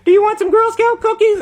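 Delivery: put-on very high little girl voice; Girl Scout. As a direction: imitate a child's sales pitch way up high. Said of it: "and they say".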